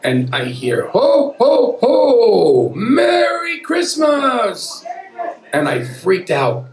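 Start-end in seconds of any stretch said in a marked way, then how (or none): 0.9-4.8 loud, high, hammed-up Santa shout; jolly Santa bellowing a greeting; booming Santa. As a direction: boom it out like Santa at the door. Santa arriving at the door.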